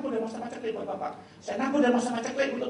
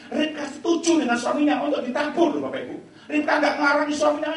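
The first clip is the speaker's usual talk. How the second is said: forceful preaching; 5 semitones higher, 7 dB louder than usual. Acted. Opening exhortation to the congregation.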